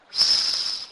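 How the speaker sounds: S as a loud sustained "sss"; letter sound demo. Shown as a demonstration. Teacher repeats the S sound for learners, hissed long. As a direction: hold S as a hiss without a vowel. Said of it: "pronounced like the S in the word cell".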